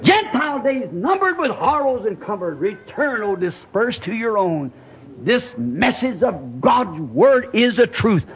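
fervent, ringing revival preaching; rousing preacher's climax; full-force preaching. Real, not acted. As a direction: proclaim it in triumph to the congregation.

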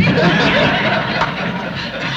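a light laugh after the punchline; amused laugh. Real, not acted. Preacher laughing at the waitress's Yankee remark.

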